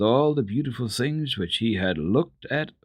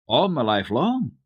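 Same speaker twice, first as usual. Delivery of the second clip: naive, wide-eyed asking; innocent question; 5 semitones higher, 4 dB louder than usual. A narrator's reading. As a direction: ask it like an innocent boy. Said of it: "asked Peter quite innocently".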